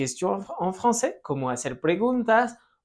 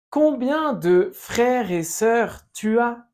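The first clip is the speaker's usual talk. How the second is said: slow model phrase; 5 semitones higher, slower than usual. Shown as a demonstration. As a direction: spell out each word for the class. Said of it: "Répète". (frase modelo muy lenta y aguda)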